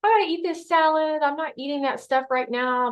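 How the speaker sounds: high-pitched sulky dieter, easing down toward normal; mock pouting. Dieter explaining the salad to friends, high mock pout. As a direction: act out a put-upon pout. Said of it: "you're kinda pouting".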